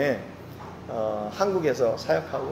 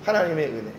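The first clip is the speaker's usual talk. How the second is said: ordinary talk, nothing marked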